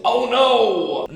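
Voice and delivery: loud, high hammed-up "oh no"; mock alarm. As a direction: fake panic, played for laughs.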